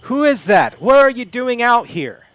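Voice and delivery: loud, high-pitched guard's challenge; shouted challenge. Acted. Guard confronting intruder in the jungle, shouted challenge. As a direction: call out sharply as a suspicious guard.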